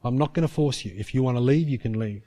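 low, soft, patient appeal; voice of the Spirit. Retold or imitated. Holy Spirit leaving Paul the choice, low gentle appeal. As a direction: ask gently without pushing, voice kept low. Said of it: "the Holy Spirit's saying".

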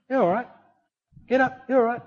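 put-on gently calm a fallen toddler; calm reassurance. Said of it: "mum just remains calm and goes".

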